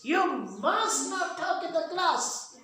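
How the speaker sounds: high mock shout of a teacher at a noisy class; teacher yelling to silence the class. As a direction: shout it like a teacher over the din. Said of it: "at the top of his lungs shouts".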